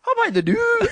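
high-pitched comic Scottish catchphrase; Scottish accent. Shown as a demonstration. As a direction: pitch it right up, hammed-up Scottish. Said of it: "okay, do Scottish".

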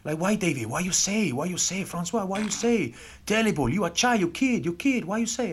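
high-pitched mock Argentinian accent; Argentinian prop. Argentinian prop scolding the youngster afterwards, imitated indignant accent. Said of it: "the Argentinian prop".

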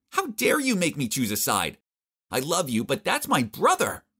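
a hurt, indignant flare-up, not a full yell; mild acted shout. Vicky refusing to choose between boyfriend and brother, indignant outburst.

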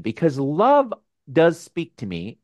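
ordinary talk

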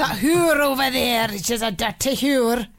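hammed-up Irish accent. Host obliges guests asking for Irish, mock Irish accent. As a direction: put on an Irish accent, amused. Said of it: "Say it in an Irish accent".

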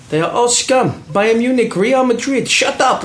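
high-pitched imitation of a ranting fan; high, emphatic mock interview voice; imitated Austrian fan. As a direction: mimic an excitable interviewee, pitched well up. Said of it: "doing an interview and he's going".

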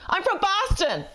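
high-pitched put-on accent; mock Hilaria accent. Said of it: "the first thing she said to him was".

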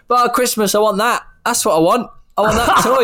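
put-on high, eager little-kid voice; a small child pleading for a toy, voiced high; imitating a child. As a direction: play the kid pointing at the telly and demanding a toy. Kid begging the alarm sensor.

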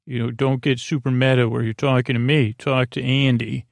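ordinary talk, nothing marked

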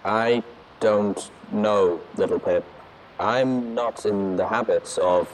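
slow, loud artificial robot voice; slow, hesitant. A narrator's reading. Watcher reluctant to send for help, slow and hesitant. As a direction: drag the words out through a tinny speaker. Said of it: "Watcher spoke slowly".

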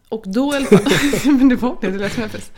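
breaking into loud laughter mid-sentence; laughing. Real, not acted. Telling her co-host about the fart, laughing loudly.

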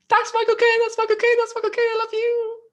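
a very high, loud, squealing mock gush; starstruck fan. Retelling spotting Michael Caine at the GQ Awards, starstruck squealing. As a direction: squeal his name over and over like a fan. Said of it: "and I was like".

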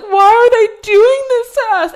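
telling how she wept at the episode, in a hammed-up loud sob; boo-hooing. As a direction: blubber it like a crying baby. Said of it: "I boo-hooed, cried".

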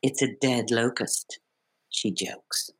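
measured read of stage directions; calm stage-direction read; script stage directions. Acted. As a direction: set the scene like a script reader.

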